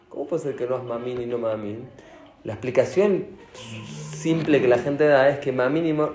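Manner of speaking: ordinary talk, nothing marked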